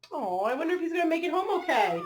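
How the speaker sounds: ordinary delivery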